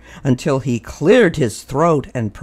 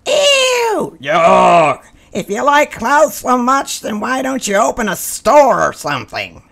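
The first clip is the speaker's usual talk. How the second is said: high, sneering group voice; mock them with loud disgust; disgusted mole rats; 10 semitones higher, 4 dB louder than usual. A narrator's reading. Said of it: "they said".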